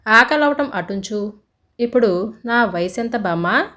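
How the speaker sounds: ordinary delivery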